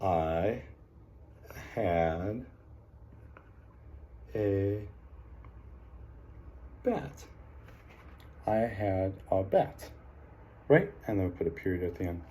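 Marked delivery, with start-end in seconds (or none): 0.0-7.2 "I had a bat" with long pauses between words, last word up and soft; dictating word by word. Shown as a demonstration. Teacher to class, slow word-by-word dictation. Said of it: "came up with the sentence". 8.5-9.9 "I had a bat" read slowly as one phrase; slow read-back. Shown as a demonstration. Teacher to class, reading the sentence back slowly. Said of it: "came up with the sentence".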